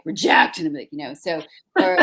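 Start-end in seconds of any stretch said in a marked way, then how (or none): 0.0-0.6 loud, higher-pitched mock rejection; rejecting admissions panel. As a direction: stamp it out like a curt verdict. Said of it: "they'd be like".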